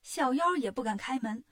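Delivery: plain reading voice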